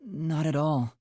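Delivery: usual speaking voice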